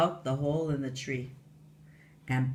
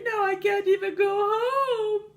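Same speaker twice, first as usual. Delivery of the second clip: much higher, tearful wail; crying fairy; 4 dB louder than usual. Acted. Tooth fairy crying to Walter, high tearful voice. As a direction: sob out the loss.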